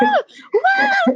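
hammed-up bawling, overcome with emotion; imitated wailing sob. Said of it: "She couldn't even understand me. I was like".

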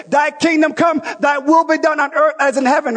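big declared prayer, will stressed; shouted prayer. Retold or imitated. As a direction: cry out the prayer like a congregation in full voice. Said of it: "here we are saying".

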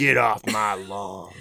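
put-on grumpy old man.

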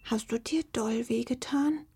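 soft, high little fox voice; contrite, hushed creature; remorseful, quiet. A narrator's reading. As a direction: ask gently in a small, near-whispered voice. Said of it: "Reumütig kam sie ... fragte leise".

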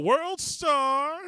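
high, loud mock holler; imitated yell. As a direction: yell it out like an excited bystander. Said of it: "Joey would have yelled out".

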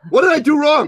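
high, loud mock panic; hammed-up squeal of male helplessness; man losing it. Man seeing a woman cry, mock panic. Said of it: "he loses it".

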